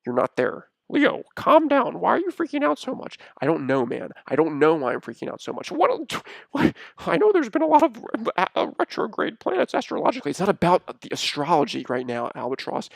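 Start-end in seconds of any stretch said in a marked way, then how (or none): 0.9-3.2 loud, very high character plea; high-pitched, loud calming-down attempt; high Albatross voice. Albatross telling freaking-out Leo to calm down, high voice. 5.7-10.3 very high, loud character voice; high-pitched, stumbling explanation; high Albatross voice. Acted. Albatross excusing Leo with astrology, high flustered voice.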